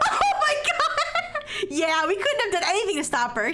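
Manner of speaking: burst of laughter. Real, not acted. Reader cracking up at the mean dialogue. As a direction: crack up mid sentence.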